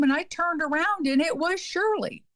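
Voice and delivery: usual speaking voice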